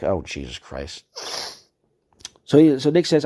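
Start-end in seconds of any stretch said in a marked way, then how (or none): 0.0-1.0 exasperated groan. Real, not acted. Exasperated by his reply.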